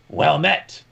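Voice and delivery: a touch of a gruff man; lightly gruff greeting; gruff man's voice. Acted. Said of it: "in the gruff man's voice".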